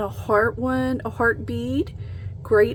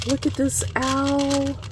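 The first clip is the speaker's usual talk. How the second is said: thrilled gasp; 5 semitones higher than usual. Real, not acted. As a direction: gasp then a higher, delighted reveal. Delighted at a bead.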